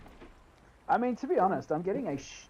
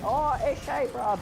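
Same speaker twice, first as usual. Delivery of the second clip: mock street-kid catchphrase; aussie eshay slang; 8 semitones higher, slower than usual. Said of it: "they're always like".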